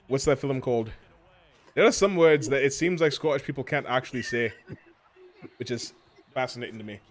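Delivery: lively put-on Scottish; conversational mock Scottish accent. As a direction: ramble on as a Scotsman. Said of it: "whenever I do a Scottish".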